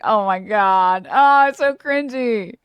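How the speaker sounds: mocking disdain. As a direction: drag out the disgust like a snob who finds it all beneath them. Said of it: "It's like".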